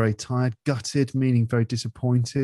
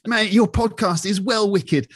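higher-pitched, slow slang demo; slangy British lad; 9 semitones higher, slower than usual. Acted. Showing the slang to the podcast host, playful lad voice.

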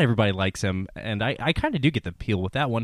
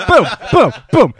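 loud laughing boom boom; rimshot sound effect; 13 semitones higher, 14 dB louder than usual. Acted. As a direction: punch it like a drum hit. Mock rimshot after joke.

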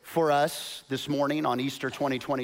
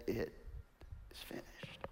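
whispered as if too weak to speak; faint dying whisper; 9 semitones lower, 21 dB quieter than usual. Shown as a demonstration. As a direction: breathe it out like giving up. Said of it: "But that's not what happened".

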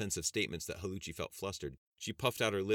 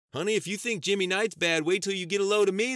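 much higher, louder yell at the screen; shouting at the TV; 14 semitones higher, 10 dB louder than usual. Acted. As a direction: holler at the television. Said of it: "the farmer shouted at the T V".

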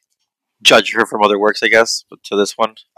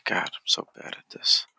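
whispering; 14 dB quieter than usual. Real, not acted. Mutters self-criticism while stuck.

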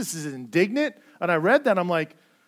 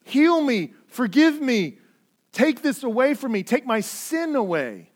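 raised, pleading cry that fades at the end; leper's plea; 7 semitones higher, 7 dB louder than usual. Retold or imitated. Leper crying out to Jesus, urgent pleading. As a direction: beg with rising urgency, then let it sink. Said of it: "He's saying".

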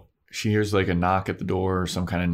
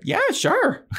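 squeaky Cordelia; 3 dB louder than usual. Retold or imitated. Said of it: "And Cordelia's like".

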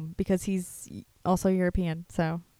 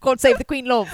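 loud, higher mock British accent; fake British accent; 4 semitones higher, 7 dB louder than usual. Closing the royal bit, loud fake British accent. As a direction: declaim it in put-on British. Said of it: "my accent's fake".

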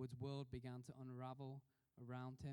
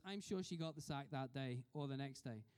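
ordinary delivery

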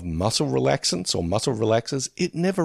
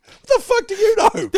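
much higher, louder, through laughter; a famous actor retorting to his meddling uncle; mock outburst; 5 dB louder than usual. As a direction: snap back at a know-it-all uncle.

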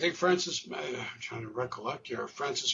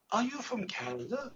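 curious caller; 7 semitones higher, 4 dB quieter than usual. Retold or imitated. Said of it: "More than one person would say".

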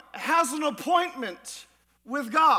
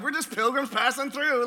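quick, offhand mimicry; flippant imitation. Pastor mimicking people who treat church life lightly, quick and flippant. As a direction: toss it off like a casual church-hopper.

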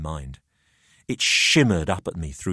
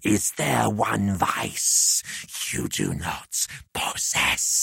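hideous hissing voice; much slower than usual. A narrator's reading. Haunting head in the narrator's mind, slow hissing whisper. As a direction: breathe the accusation menacingly. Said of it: "hideous, hissing voice".

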